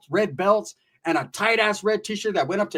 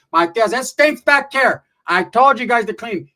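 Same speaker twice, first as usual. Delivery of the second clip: higher, loud nagging relative; scolding; 5 semitones higher, 7 dB louder than usual. Retold or imitated. Aunt complains about the stink, raised scolding voice. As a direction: call it out in a raised mock complaint.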